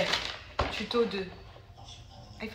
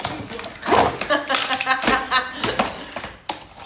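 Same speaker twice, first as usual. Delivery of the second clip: long peal of helpless laughter; burst of laughter. Real, not acted. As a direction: keep laughing, unable to stop. Rit de son meuble à monter. (long éclat de rire)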